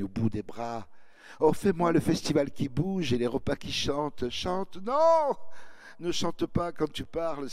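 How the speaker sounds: loud, gushing, begging rhapsody; pleading girl. Retold or imitated. The girl begging the narrator to talk about Toulouse, loud and gushing. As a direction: beg him breathlessly to praise Toulouse. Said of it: "C'est la petite qui se répandit".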